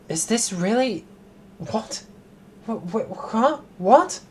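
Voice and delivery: high, rising stammer up to a loud what; stunned stammering what; flabbergasted. Real, not acted. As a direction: splutter in disbelief. Stunned by the game's reveal.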